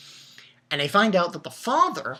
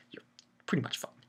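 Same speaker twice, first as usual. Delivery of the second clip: whispered aside; 4 semitones lower, 10 dB quieter, faster than usual. Real, not acted. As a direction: drop to a breathy whisper for the punchline. Rain hits suits, aside.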